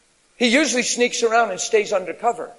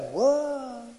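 imitating an impressed gasp; hushed mock wow; mock awe; 3 semitones higher, 5 dB quieter than usual. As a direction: play the gullible amazed crowd. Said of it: "That is like".

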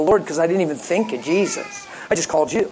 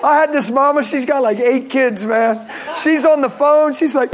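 ordinary delivery

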